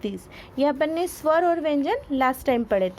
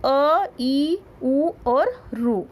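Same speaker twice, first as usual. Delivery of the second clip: slow vowel list; much slower than usual. Shown as a demonstration. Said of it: "कौन से, कौन से?".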